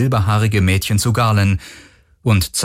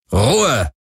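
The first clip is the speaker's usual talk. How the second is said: higher-pitched, sharp order; commanding; 4 semitones higher than usual. A narrator's reading. Captain silencing her two squabbling passengers, commanding. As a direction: order them both silent. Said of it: "Beide verstummten".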